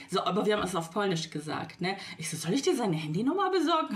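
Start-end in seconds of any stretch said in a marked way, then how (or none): 2.4-3.9 higher, playful teasing offer; jokingly. Retold or imitated. Teasing her aunt, offering to get the man's number, playful. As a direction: tease your aunt with a grin in the voice. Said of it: "hab ich so aus Spaß gesagt".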